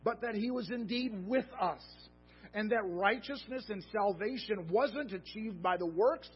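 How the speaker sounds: impassioned sermon delivery; fervent preaching. Acted. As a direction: proclaim it to the congregation. Preaching at raised pitch.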